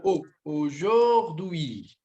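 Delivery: aujourd'hui stretched, the "au" said as a clear O; French teacher modelling aujourd'hui slowly; slow careful aujourd'hui. Shown as a demonstration. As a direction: drawl out "au, aujourd'hui" syllable by syllable. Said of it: "do fonema A U".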